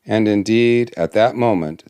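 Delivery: ordinary delivery, nothing marked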